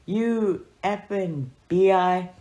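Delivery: angry curse. Retold or imitated. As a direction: spit it out slowly, furious. Grandpa cursing the nun.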